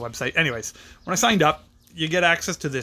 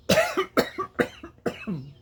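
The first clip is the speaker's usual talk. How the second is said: coughing fit. Real, not acted. Just swallowed a bug.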